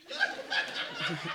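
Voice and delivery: short real chuckle; laughing. Real, not acted. Teacher amused as people turn to their neighbours, laughing. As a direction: break into a laugh. Amused by singing to one another.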